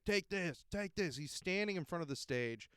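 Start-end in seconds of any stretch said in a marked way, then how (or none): 0.1-1.2 mock drunk shout. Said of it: "He's like".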